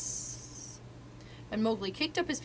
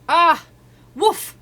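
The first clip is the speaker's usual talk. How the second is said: startled cry; 9 semitones higher, 15 dB louder than usual. Acted. Baloo's paw scoops Mowgli up.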